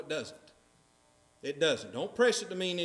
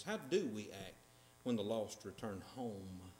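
soft, lower musing on "How do we act"; quiet question; 12 semitones lower, 9 dB quieter than usual. Shown as a demonstration. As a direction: ask "How do we act" softly, almost to yourself. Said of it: "that's gonna be the application of this".

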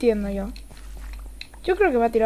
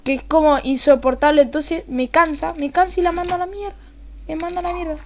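ordinary talk

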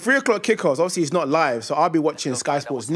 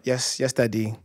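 meek; 5 semitones lower, 5 dB quieter than usual. Retold or imitated. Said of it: "I'm like".

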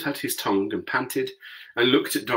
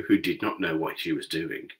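ordinary delivery

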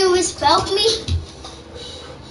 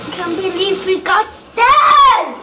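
triumphant yell at full volume; excited shout; 2 semitones higher, 7 dB louder than usual. Real, not acted. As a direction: shout it out in disbelief.

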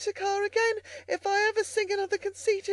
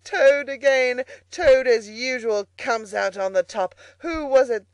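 loud, high boasting as Toad; ecstatic self-admiration; 5 semitones higher, 7 dB louder than usual. A narrator's reading. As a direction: crow triumphantly, praising yourself to the skies. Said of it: "he cried in ecstasies of self-admiration".

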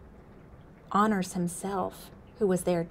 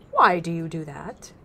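a touch of a demanding chief; lightly voiced chief, louder; buffalo chief; 6 dB louder than usual. Honors himself questions the frog.

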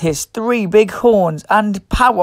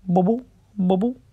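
softer, bubbly underwater voice; soft bubbling sound; 5 dB quieter, faster than usual. Acted. Reading to children, soft bubbling for the ichthyosaur. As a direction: make it sound like bubbles rising.

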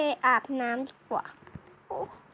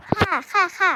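flustered passerby; 7 dB louder than usual. Acted. Passerby told to move out of the way, flustered yeses. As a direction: rattle off agreement hurriedly. Stranger brushed aside in crowd.

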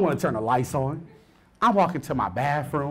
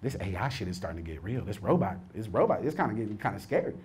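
low uneasy inner voice; 9 semitones lower, 12 dB quieter than usual. Retold or imitated. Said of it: "I thought to myself".

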